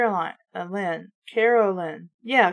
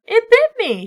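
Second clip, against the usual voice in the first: high, loud cry of alarm; loud mock-frightened yelp; 12 semitones higher, 7 dB louder than usual. A narrator's reading. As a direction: cry out as if bitten. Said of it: "she cried".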